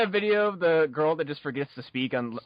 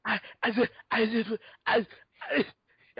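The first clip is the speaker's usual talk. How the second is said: stammering high and breaking; tongue-tied fan; 5 semitones higher than usual. Pretend. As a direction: awestruck stammer near tears.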